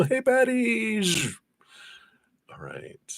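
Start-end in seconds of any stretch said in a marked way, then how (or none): 0.0-1.0 drawn-out, high-pitched hello; a long, high holler to someone long gone; calling out. Pretend. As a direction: call out slowly, way up high.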